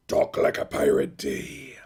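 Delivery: slow, growling pirate announcement; hammed-up pirate drawl. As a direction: announce it like an old movie pirate. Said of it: "that was his voice that everybody's tried to emulate".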